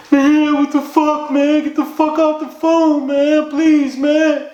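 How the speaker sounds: high, drawn-out mock-crying wail; imitated crying plea. Crying friend begging caller to hang up, imitated sobbing plea. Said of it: "he just started sound like a crying".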